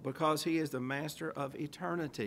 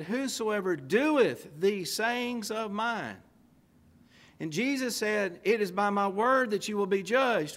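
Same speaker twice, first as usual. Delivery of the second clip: fervent preaching; 4 semitones higher, 5 dB louder, slower than usual. Acted. Preaching at full force.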